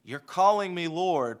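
ordinary delivery

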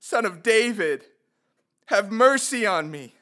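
loud, high, pleading cry. Acted. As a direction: call out for mercy over a crowd. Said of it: "he cried out all the more".

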